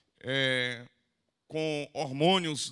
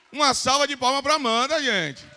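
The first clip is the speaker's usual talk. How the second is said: louder, higher applause call; 7 semitones higher, 8 dB louder than usual. Real, not acted. Repeats the call, higher and rising.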